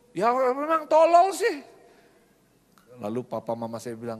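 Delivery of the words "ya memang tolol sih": high, loud mock retort; childish back talk. Child answers scolding father about the maid, high defiant retort. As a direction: protest like a kid talking back.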